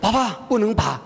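put-on high, muffled child's plea behind a hand; a scared kid refusing through covered mouth; childish voice through cupped hands; little girl, mouth covered. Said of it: "把嘴巴捂着".

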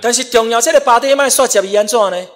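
high, loud preacher's exhortation; forceful preaching. Real, not acted. Preaches with raised voice.

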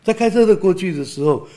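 normal talking voice

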